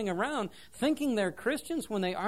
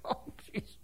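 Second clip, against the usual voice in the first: whispered aside; 10 dB quieter than usual. Retold or imitated. Reacting to the gossip.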